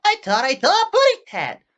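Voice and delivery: very high, loud tiny-canary voice; loud, tiny cartoon bird voice. Shown as a demonstration. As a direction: squeak it out like a little cartoon bird. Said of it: "do the standard".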